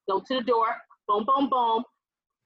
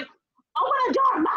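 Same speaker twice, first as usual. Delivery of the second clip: exasperated daughter; 11 semitones higher, 3 dB louder than usual. Retold or imitated. Daughter at mom's locked door, exasperated. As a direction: imitate impatient pleading, pitched way up. Daughter retells demanding entry.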